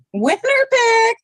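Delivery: high, slow, drawn-out cheer; excited shout. Real, not acted. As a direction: stretch it out, sing-song and thrilled.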